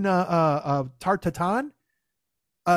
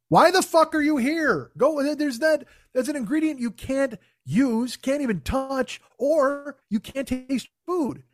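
exasperated rant; 6 semitones higher, slower than usual. Acted. Berating contestants who can't taste their ingredients, exasperated rant. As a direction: hammer it out in comic disbelief. Ranting at allergic cooking contestants.

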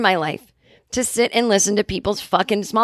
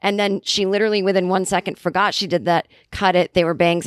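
normal talking voice

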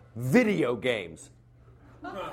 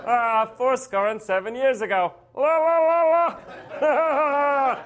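high nasal voice, singsong la la; squeaky nasal speech with sung la's; high nasal Lincoln; 9 semitones higher, 5 dB louder than usual. Retold or imitated. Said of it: "high-pitched, nasally voice".

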